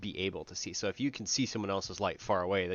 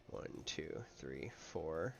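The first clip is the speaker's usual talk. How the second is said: whispered counting; 4 semitones lower, 10 dB quieter, much slower than usual. Shown as a demonstration. Counting again, near whisper.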